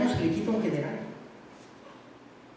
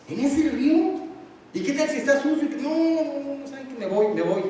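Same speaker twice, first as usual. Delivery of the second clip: indignant general; 8 semitones higher than usual. Acted. Naamán refusing the river.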